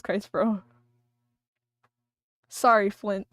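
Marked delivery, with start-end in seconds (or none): none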